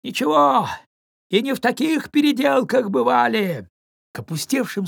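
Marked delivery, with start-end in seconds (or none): 0.0-3.7 hearty shout. Acted. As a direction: shout it cheerily into the wind. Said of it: "И добавил".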